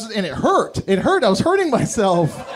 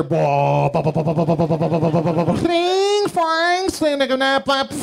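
toilet noises; 2 semitones lower than usual. Retold or imitated. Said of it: "you just hear".

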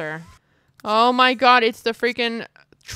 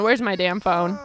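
ordinary talk

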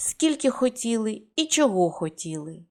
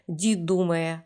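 plain reading voice

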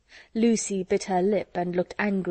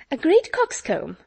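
high, louder repeat of overheard words; exclaimed echo; 9 semitones higher, 5 dB louder than usual. A narrator's reading. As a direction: pounce on the overheard phrase, pitched well up. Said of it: "repeated Miss Steele".

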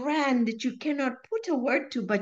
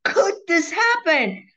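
higher, louder, slow "how could this happen"; outraged shout; 8 semitones higher, 8 dB louder, slower than usual. Retold or imitated. Retelling to Tomo her outburst in the dark, loud and slow. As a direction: demand an answer in disbelief. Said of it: "I remember shouting in the dark".

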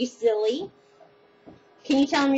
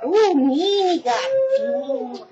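mock offended tease; 6 semitones higher, 5 dB louder, slower than usual. Teasing grandchild who refuses.

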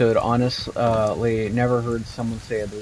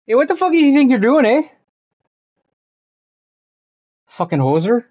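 loud, high-pitched Canadian hoser accent; 9 semitones higher, 6 dB louder than usual. Shown as a demonstration. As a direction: lay the Canadian accent on thick. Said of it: "stereotypical Canadian accent would probably be something like this".

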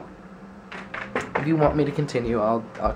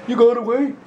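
high squeaky voice; 12 semitones higher, 7 dB louder than usual. Acted. Sudden squeaky aside.

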